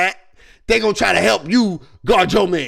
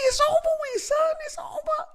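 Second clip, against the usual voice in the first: very high squeaky "It's over", fading softer; it's over wail; 6 dB quieter than usual. Pretend. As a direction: wail it in a mock falsetto. Dramatic despair.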